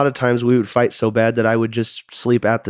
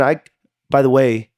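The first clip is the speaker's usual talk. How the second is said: ordinary talk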